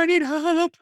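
high-pitched hammed-up wail; imitated tearful plea, very high; mock crying. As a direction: wail it out like a cartoon sob. Retold tearful plea, voice shoots up.

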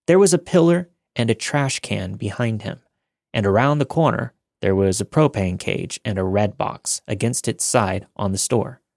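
plain reading voice

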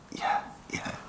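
a soft, breathy whispered yeah yeah; whispered yes. Retold or imitated. Saying yes to the offering, whispered. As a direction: murmur assent under your breath. Voicing the required answer.

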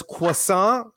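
croissant said the affected French way, "kwa-SAHN"; mock French accent. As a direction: put on a pretentious French accent for croissant, nasal ending and silent T. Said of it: "say croissant with an accent".

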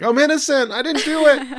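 high, loud cartoonish cry of innocence; panicked mock protest. Waking Kogoro protests in retold scene.

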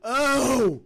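loud high Oh; surprised gasp. Real, not acted. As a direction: gasp in shock at the exit line. Reacting to Izzy's exit.

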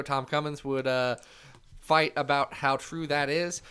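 ordinary delivery

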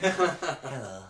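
a lightly imitated lovely, friendly hello; Brian Cox. Fan showing the presenter's lovely approach, light impression. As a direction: greet like the northern science presenter. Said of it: "Brian's got a much more lovely approach".

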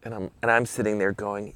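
ordinary delivery

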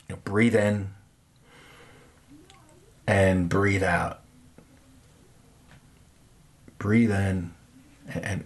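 guided meditation. Acted. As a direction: lead them gently through each breath. Leads a breathing meditation.

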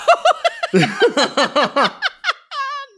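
long squealing laugh; high shrieking laugh. Real, not acted. Host shrieks with laughter at being called too proud to ask help. Answering if she asks help.

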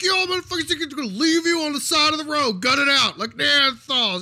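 very loud, high-pitched mock tirade; screaming coach imitation. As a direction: scream at the defense from the sidelines. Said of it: "he screams at the defense".

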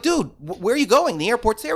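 exasperated cry of where are you going; alarmed protest. Retold or imitated. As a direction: replay your panic at the driver. Retold panic at wrong turn.

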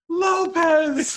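high, loud drawn-out holler; hammed-up sketch shout; yelled name. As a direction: call out hard in mock alarm. Acting final yell of sketch.

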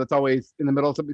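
ordinary talk, nothing marked

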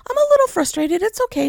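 politely frustrated. Retold or imitated. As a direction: play down your frustration in a high, sweet voice. Polite reply to phone lady.

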